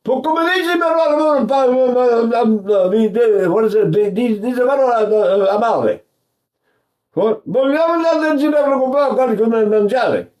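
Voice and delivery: high rapid Neapolitan babble; Neapolitan chatter. Retold or imitated. Teacher mimics Neapolitan dialect speakers chattering. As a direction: babble like a Naples local. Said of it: "what are they doing".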